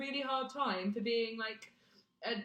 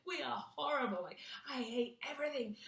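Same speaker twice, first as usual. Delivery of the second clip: mock despair; 4 semitones higher, 4 dB quieter than usual. Voicing low self-esteem.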